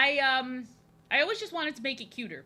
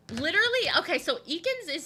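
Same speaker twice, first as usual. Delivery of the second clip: high, loud burst of glee; excited squeal; 8 semitones higher, 7 dB louder than usual. Real, not acted. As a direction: squeal the word with delight. Reacting to viewer request.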